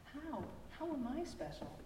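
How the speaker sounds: put-on puzzled child. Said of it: "Maddie shrugged".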